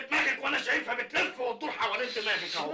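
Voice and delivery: high, loud mocking retort; shouting back. Mocking shout at his partner in front of the gang. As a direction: throw it back at her full voice. Teasing argument escalates.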